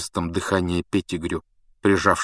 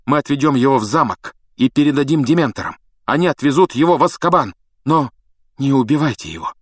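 higher, urgent, somewhat breathless; breathing heavily; 6 semitones higher, 5 dB louder than usual. A narrator's reading. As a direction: plead urgently, a touch out of breath. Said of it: "тяжело дыша сказал Гарри". (взволнованно, тяжело дыша)

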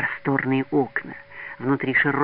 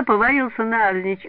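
ordinary delivery, nothing marked

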